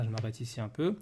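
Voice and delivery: ordinary talk, nothing marked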